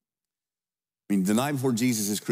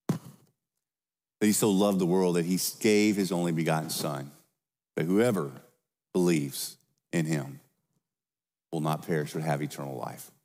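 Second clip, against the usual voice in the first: scripture reading; 5 semitones lower than usual. Acted. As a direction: let the verse settle gently. Reads John 3:16 softly.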